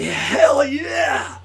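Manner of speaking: high, loud whoop of yes; hyped cry of Hell yeah; excited shout. Pretend. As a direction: shout it like you mean it. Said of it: "make you say".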